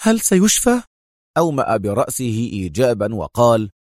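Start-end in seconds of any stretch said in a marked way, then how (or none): 0.0-0.8 high, anxious question; concerned. A narrator's reading. Mona asks about wounded Khaled's recovery, concerned. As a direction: ask with eager concern, pitched high. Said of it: "سألته منى في اهتمام".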